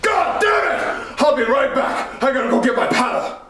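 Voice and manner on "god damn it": angry yell. Acted. Teacher furious at the class.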